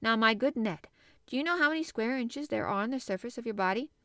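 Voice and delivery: ordinary delivery, nothing marked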